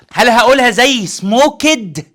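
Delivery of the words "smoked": "سموكد" slowly as smo-ked; wrong extra syllable. Shown as a demonstration. Teacher asks if it is said so, two loud syllables. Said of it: "لا".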